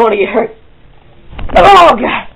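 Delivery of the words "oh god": very high, loud yelp of fright and pain; real loud yelp mid-fall; loud outcry. Real, not acted. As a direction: shriek as you fall. Second bed flop onto floor.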